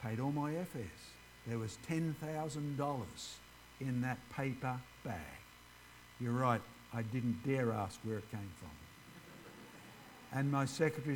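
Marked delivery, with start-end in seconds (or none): none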